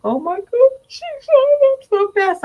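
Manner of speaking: high, wailing mock sob; pretend crying. Parent mourning how fast she grows, pretend crying. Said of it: "pretend like you're crying".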